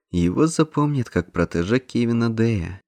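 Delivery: ordinary delivery, nothing marked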